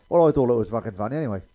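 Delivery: usual speaking voice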